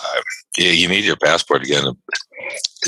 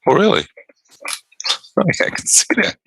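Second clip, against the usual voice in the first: sarcastic disbelief. Retold or imitated. Mocking the clerk's booked-solid claim, sarcastic high disbelief. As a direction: jump way up in mock amazement.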